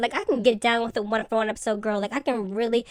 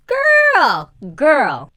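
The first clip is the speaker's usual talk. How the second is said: excited shriek; 5 semitones higher, 10 dB louder than usual. Real, not acted. Vlogger squealing to viewers over Uncle Lump's entrance. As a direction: shriek it out in sheer delight. Reacting to Uncle Lump walking in.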